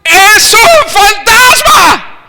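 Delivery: high, slow, drawn-out ghost scream; terrified scream. Retold or imitated. Disciples screaming at the figure on the water.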